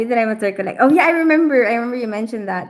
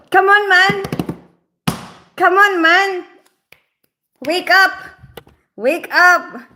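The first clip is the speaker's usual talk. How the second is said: exasperated shout; 8 semitones higher, 5 dB louder, slower than usual. Real, not acted. Scolding Sid, exasperated.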